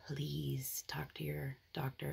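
slow, soft, sincere plea; earnest whispered plea. Real, not acted. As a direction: plead gently with the viewer.

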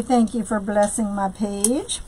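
normal talking voice

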